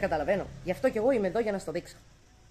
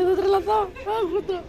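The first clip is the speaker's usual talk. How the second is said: excited squeal; 12 semitones higher, 5 dB louder than usual. Real, not acted. Cats swarm her for food.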